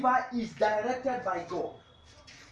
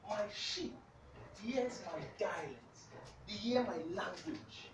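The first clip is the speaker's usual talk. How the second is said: hushed; 8 dB quieter than usual. Real, not acted. Hushed retelling of the verse.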